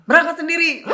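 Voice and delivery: loud, high-pitched mock scolding; imitated Batak mother. Mother refuses to take daughter to convent, shouting high. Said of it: "Orang Batak".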